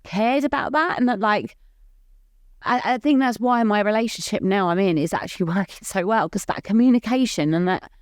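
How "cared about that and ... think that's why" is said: higher voice, welling up with real emotion; fighting back tears while talking; getting emotional. Real, not acted. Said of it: "I don't know why I'm getting emotional about it".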